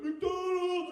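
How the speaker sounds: high, loud mock-kid cheer; excited kids. Kids answer mom's question, loud imitated excited cheer. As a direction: cheer it like children going wild.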